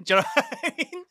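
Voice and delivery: high laughing question. Real, not acted. Laughing at his own lie to his son's school. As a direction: laugh it out at your own joke.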